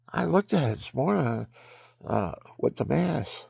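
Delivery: talking through hiccups; hiccuping between words. Real, not acted. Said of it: "the reason why I'm hiccuping".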